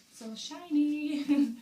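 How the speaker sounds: high, slow and drawn out; delighted. Real, not acted. Admiring the shiny book cover, high and drawn out. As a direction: savour the word like a magpie.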